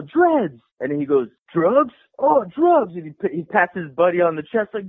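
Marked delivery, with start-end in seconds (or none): 0.0-0.6 higher-pitched retold call; mock yell of a nickname; imitated yell. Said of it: "I yell out". 1.5-2.9 imitated eager surprise. Misheard Dreads, delighted hippie.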